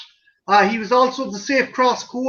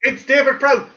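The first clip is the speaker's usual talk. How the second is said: normal talking voice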